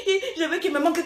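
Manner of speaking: a hint of a sulky wife quoting her wish; mock whiny wife. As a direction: lightly imitate a whining fiancée. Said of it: "Après tu me dis".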